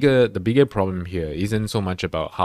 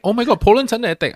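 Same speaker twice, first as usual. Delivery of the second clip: high-pitched, rushed fake alarm; mock panic; 14 semitones higher, 6 dB louder, faster than usual. Host mocking influencers who can't find Ukraine, in mock panic. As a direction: blurt it out like a clueless panicker.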